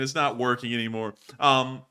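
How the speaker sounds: ordinary delivery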